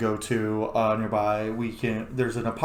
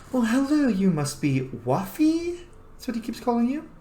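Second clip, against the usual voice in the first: lightly voiced sultry fox; 11 semitones higher than usual. Veladonna meeting Waffle, lightly voiced coy purr. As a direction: greet him coyly. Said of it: "she just goes".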